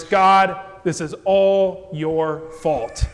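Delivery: loud, slow accusing outburst; angry Jonah. Retold or imitated. Preacher as Jonah blaming God, loud and slow. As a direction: hurl the blame at God, drawn out. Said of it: "so he's all angry about it".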